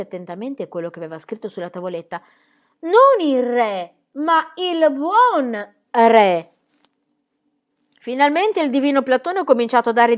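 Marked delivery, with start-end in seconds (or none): 4.1-6.5 "buon" drawn out very slowly and hit hard; stress on buon. Acted. Corrects the king to the good king.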